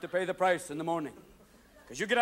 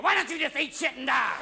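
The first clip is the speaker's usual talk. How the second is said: snapping; 5 semitones higher than usual. Retold or imitated. Hungover snapping.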